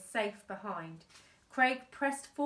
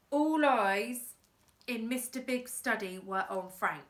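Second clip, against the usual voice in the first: plain reading voice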